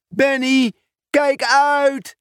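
high warning call to the bird. Acted. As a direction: shout a warning as Benny flies. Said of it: "riep Pieter Post". (hoog waarschuwend roepen)

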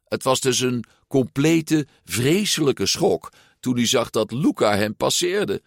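plain reading voice

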